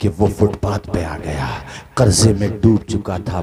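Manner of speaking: low, soft, grave telling of a fall; hushed storytelling. A narrator's reading. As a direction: draw the crowd in with a hushed voice. Sermon story turns hushed. (धीमी, गंभीर कथा-वाचन की आवाज़)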